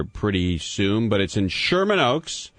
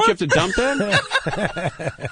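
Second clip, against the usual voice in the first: laughing; 10 semitones higher, slower than usual. Real, not acted. Host teases, laughing.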